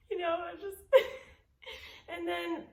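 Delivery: real tears breaking through the laugh; choked laugh-crying. Real, not acted. Tearing up while retelling grief.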